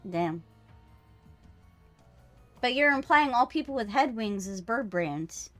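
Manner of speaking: ordinary delivery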